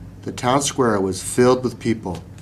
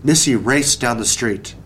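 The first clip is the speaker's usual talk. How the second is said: ordinary delivery, nothing marked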